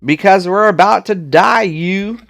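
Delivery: high, loud Southern panic; country accent. Acted. Trucker brother screaming doom in Southern accent. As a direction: shriek it like a doomed hillbilly. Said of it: "they just sound very country, southern".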